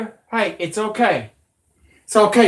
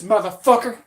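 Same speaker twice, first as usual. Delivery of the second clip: normal talking voice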